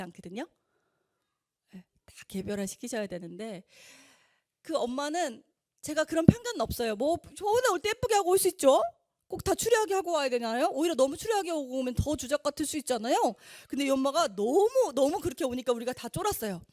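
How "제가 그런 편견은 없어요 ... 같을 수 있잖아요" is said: a hint of the bold mother.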